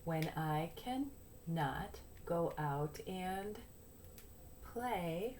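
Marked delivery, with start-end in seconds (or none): none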